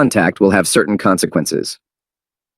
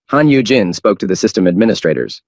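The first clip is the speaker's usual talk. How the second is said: usual speaking voice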